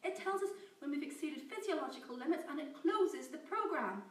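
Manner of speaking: high, loud frantic protest; desperate shouting. Acted. Scientist Amy shouting denial at her captor. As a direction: insist desperately, near tears.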